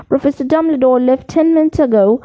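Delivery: plain reading voice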